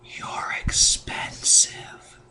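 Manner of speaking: slow, unvoiced-sounding stage whisper; hushed, drawn-out whisper. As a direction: whisper it slowly into a pregnant belly. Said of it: "I would whisper in her belly button".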